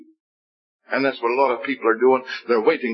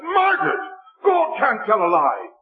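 high crying shout at his sister; 10 semitones higher than usual. Retold or imitated. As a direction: burst out, voice breaking. Defending God, crying out to sister.

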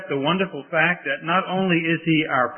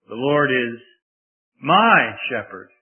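"my" louder and slowed; stress on MY; 3 semitones lower, 3 dB louder, slower than usual. Shown as a demonstration. Preacher making the verse personal to listeners, my stressed. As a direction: press on the word my. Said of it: "Now it's personal".

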